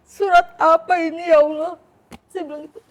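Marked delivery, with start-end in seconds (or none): 0.0-1.7 loud, high, choked-up plea to God; tearful high cry to God; tearful prayer. Retold or imitated. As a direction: cry out to God, on the edge of tears. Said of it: "Saya nangis".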